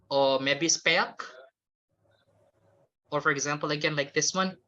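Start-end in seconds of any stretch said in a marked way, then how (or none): 0.7-1.2 slow "speak" with an open vowel, like "spack"; mispronounced speak. Shown as a demonstration. Teacher offering another learner error, "speak" mispronounced as "spack". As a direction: draw "speak" out as "spæk", rising. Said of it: "When you don't pay attention to pronunciation".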